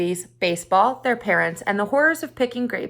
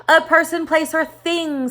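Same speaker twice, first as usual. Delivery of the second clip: higher, sing-song chant; class in unison; 8 semitones higher, 5 dB louder than usual. A narrator's reading. Students answer the teacher together in a chant. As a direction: recite it like a whole class. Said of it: "said the class in unison".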